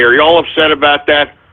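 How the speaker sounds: ordinary talk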